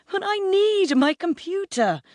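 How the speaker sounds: very high-pitched whine; desperate wail. A narrator's reading. Son begging mum for his computer, desperate.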